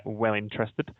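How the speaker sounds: ordinary talk, nothing marked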